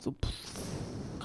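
soft unvoiced puff of breath; sleepy puff sound. Shown as a demonstration. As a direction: breathe out like someone asleep. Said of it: "Schlafgeräuschen".

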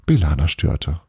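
ordinary delivery

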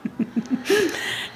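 short high amused laugh; a bright real laugh before answering. Real, not acted. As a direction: burst out laughing at the question. Asked about politics, laughs.

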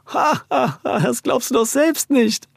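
high mocking laugh through the words; laughing loudly. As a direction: laugh him off loudly and slowly. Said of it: "lachte der Mann laut auf".

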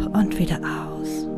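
breathy whisper on the exhale; soft spa whisper. Acted. Breathing cue in meditation. (gehauchtes Flüstern)

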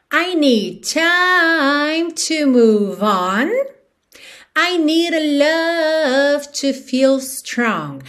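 very slow, "time" and "love" held long; song rhythm. Shown as a demonstration. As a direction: stretch the lyric as if half singing. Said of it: "te dando no ritmo da música".

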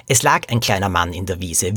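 usual speaking voice

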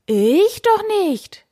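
very high, drawn-out panicky refusal; cowardly cry. A narrator's reading. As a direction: squeal it like a cowardly knight. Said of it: "rief der Ritter". (ängstlich und hoch gerufen)

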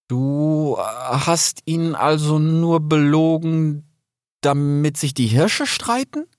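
hohe, langsame, verwirrte Frage; verwirrter Rix. A narrator's reading. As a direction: deutlich höher, langsam und ratlos. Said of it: "fragte er dann verwirrt". (hohe, langsame, ratlose Frage)

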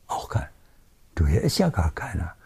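put-on whispered reassurance to a child at bedtime; soothing whisper. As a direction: hush it as if the child might wake. Mutter flüstert beruhigend zum Kind. (Mutter flüstert beruhigend: hier ist keiner)

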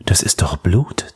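breathy unvoiced whisper to herself; hushed, barely voiced shock; whispered realisation of blood; whispered thought. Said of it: "dachte sie".

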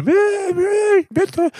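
very high whiny bleat, then a begging "bitte"; mock whimpering. Mocking his scared friends on the gondola, squeaky whimpering plea. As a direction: mimic scared friends mewling like babies. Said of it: "die so geschrien".

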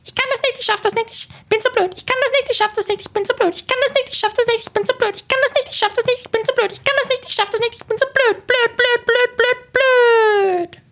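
squeaky high broken-record loop; Mickey Maus. Shown as a demonstration. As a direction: repeat like a stuck record in a tiny cartoon squeak. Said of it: "Diese Schallplatte klingt dann so". (Piepsstimme wie eine hängende Schallplatte)

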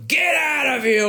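slow, drawn-out English yell, pitched high; hammed-up zombie scream at the visitors; mock shout. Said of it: "und dann so". (gespielter Schrei eines Zombie-Darstellers)

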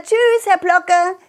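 high, slow giggly goodbye; cheeky laughing goodbye. Acted. Child runs off from the neighbour, laughing a cheeky goodbye. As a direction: tease him while laughing.

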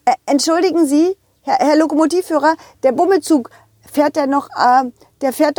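fast, eager question; hurried. Acted. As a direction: rush to ask. Hurried call to driver.

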